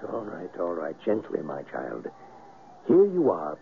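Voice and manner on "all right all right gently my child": soothing gently. Acted. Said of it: "Gently, gently".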